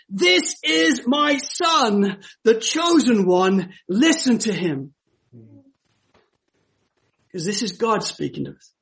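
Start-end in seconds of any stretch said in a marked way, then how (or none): 0.1-4.9 higher, slow and ringing on this; ringing proclamation. Acted. Preacher urges friends to hear God, ringing and higher. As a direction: let it ring in their ears. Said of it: "we should have ringing in our ears".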